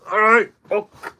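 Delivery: retold angry retort to the officer; exasperated outburst. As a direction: spit it out, fed up and beaten. His retort while pinned down.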